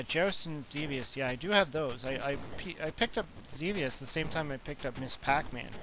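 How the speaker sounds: ordinary talk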